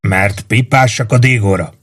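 plain reading voice

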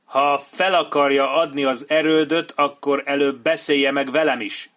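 loud furious shout at him; shouting at the commander. A narrator's reading. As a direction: roar it in his face. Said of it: "üvöltött Benny Singre".

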